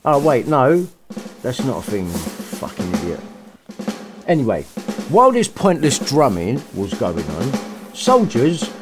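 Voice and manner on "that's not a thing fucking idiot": ordinary delivery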